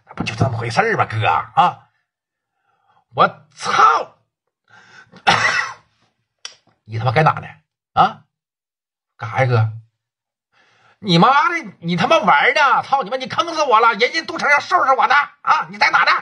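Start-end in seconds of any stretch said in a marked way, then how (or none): 3.2-4.2 sudden high exclamation; shocked outburst. Acted. Boss hearing the lame excuse, shocked outburst. 11.0-16.1 furious yelling. Acted. Boss yelling that he is ruined, furious.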